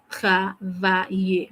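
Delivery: Travailler drawn out slowly; slow model word. Shown as a demonstration. Teacher modelling the verb for students, slowly. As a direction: stretch the word for repetition. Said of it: "The verb here is travailler".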